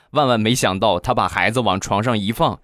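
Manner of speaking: normal talking voice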